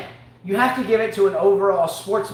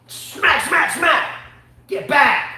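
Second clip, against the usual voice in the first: high, loud smacks; mock attack yell; 7 semitones higher, 4 dB louder, much slower than usual. Dad pretending to swat with a sandal, mock attack yell. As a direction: yell out each blow. Acting out beating with a shoe.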